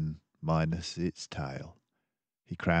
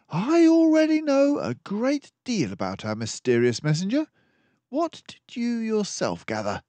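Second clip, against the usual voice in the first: much higher, louder crisp detective mouse; a composed mouse detective taking charge; Basil the detective; 6 dB louder than usual. Acted. Basil quizzes Dawson.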